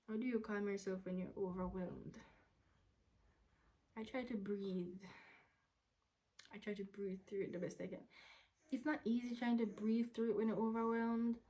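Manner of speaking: usual speaking voice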